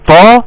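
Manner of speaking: "طا" thick and full, emphatic t; heavy Ta. Shown as a demonstration. Teacher contrasting two letters for students, heavy Ta. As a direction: back the tongue for a heavy "طا". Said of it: "وتوضح لي الفرق".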